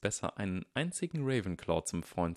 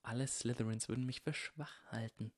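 hushed, higher whispery voice; whispering; 4 semitones higher, 8 dB quieter than usual. A narrator's reading. Draco confides his fear of looking weak, whispering. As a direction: keep it secret, very soft. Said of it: "flüsterte Draco".

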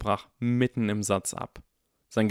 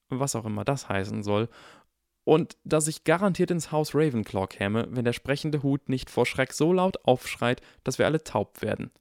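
higher, animated quoting; chattering young Harry; 4 semitones higher than usual. Acted. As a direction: recite the verdict with lively emphasis.

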